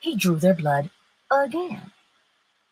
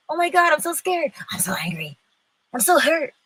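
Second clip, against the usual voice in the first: mock panic; 8 semitones higher than usual. Preacher mimics fearful, angry, hurt listener.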